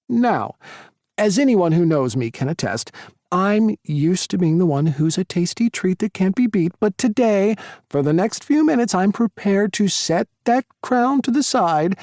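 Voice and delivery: high, loud theatrical host; showman announcer. Acted. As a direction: build it up for the crowd. Tyler's cafe announcement.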